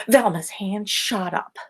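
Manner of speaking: ordinary delivery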